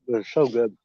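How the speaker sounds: ordinary talk, nothing marked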